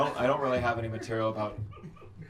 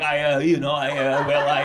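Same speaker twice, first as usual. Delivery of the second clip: nervous friend stammering, a bit louder; mock stammering; 2 semitones higher, 8 dB louder than usual. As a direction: fumble the words like a nervous friend. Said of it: "and then they start stammering".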